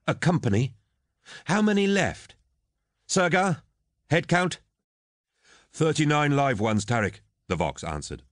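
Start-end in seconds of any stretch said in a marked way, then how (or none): 0.1-4.6 much higher-pitched, pressing questions; urgent demand. Acted. Captain calling for a head count of losses, urgent. 5.7-7.2 higher-pitched trooper reporting in; vox report. Acted. Trooper answering captain over the vox, reporting.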